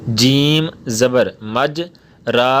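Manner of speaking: letters spelled slowly one by one; slow hija recitation; slow spelling out. Shown as a demonstration. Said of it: "امالہ کے ہجے کس طرح".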